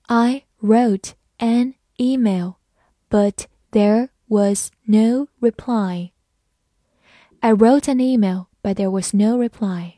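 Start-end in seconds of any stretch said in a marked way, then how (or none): 0.0-6.1 slow, careful word-by-word read. Shown as a demonstration. Teacher reading slowly for students. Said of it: "慢慢来读".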